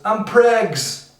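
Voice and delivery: put-on high-pitched girlfriend voice; girlfriend announcing. Girlfriend announces her pregnancy, high and pointed. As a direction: pipe it up as news. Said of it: "She announces".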